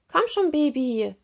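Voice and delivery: cheering on. A narrator's reading. Mermaid to the baby turtle crossing sand, cheering. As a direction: call out to spur a little one on. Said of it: "feuerte Serena das Baby an".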